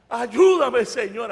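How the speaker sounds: acted desperate prayer shout; retold cry for help. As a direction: call out for rescue, then ease off.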